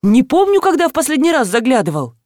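usual speaking voice

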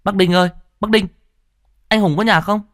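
high, fast call across the yard; calling out loud. A narrator's reading. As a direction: shout to the old man sweeping the yard. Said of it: "tôi nói to". (gọi to, giọng cao và nhanh)

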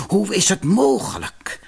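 lamenting, at a loss; sighing disbelief. A narrator's reading. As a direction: sigh it out in disbelief. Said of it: "verzuchtte ze".

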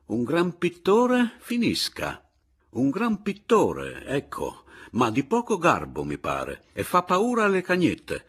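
high, loud sneering retort; resolute and scornful. A narrator's reading. Narrator retorts to the aggressive painter, resolute and scornful. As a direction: spit it out with contempt, dragging the key words. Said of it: "gli dissi io allora risoluto e sprezzante".

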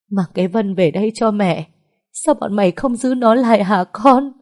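rising to a loud, high tearful cry; a mother crying for her lost daughter; sobbing plea. A narrator's reading. Mother sobbing at her bedside, begging for her daughter, tearful. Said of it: "Mẹ Từ nức nở".

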